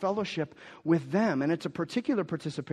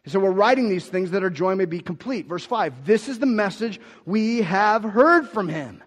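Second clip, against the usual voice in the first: raised, emphatic scripture reading; preaching scripture; 6 semitones higher, 8 dB louder than usual. Acted. Pastor reading the verse aloud, raised and emphatic. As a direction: lift the verse up to them.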